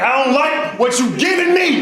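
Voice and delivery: high, slow whiny yell; high pitched whiny shout; high complaining shout. Retold or imitated. Comic complaint to the doctor.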